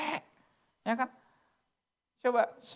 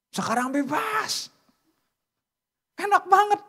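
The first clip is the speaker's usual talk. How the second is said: delighted; 9 semitones higher, 4 dB louder than usual. Acted. Preacher mimics the carefree freedom.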